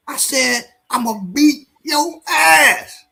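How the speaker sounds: high, hammed-up threat with shouted bits; threatening young man. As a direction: brag to the stranger about beating the old man. Young man restating his threat.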